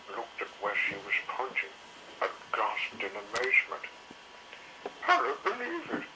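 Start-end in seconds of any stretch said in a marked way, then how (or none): 5.1-6.0 high, astonished Missus Carey; high startled lady dinosaur; amazed cry. A narrator's reading. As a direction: gasp it out in disbelief. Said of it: "she cried".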